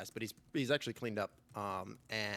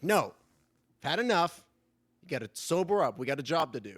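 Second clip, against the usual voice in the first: louder, higher stern telling-off; firm scolding; 5 semitones higher, 7 dB louder than usual. Acted. Elf partner scolding her drunk partner, loud and stern. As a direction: shut him down, no arguing. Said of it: "And she's like".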